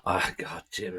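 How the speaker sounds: normal talking voice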